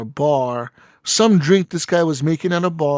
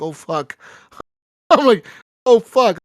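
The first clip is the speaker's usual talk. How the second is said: loud, high, laughing Oh fuck; high, loud laughing alarm; laughing panic; 6 semitones higher, 8 dB louder than usual. Real, not acted. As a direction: blurt it out through a laugh. Panics after shooting the rival.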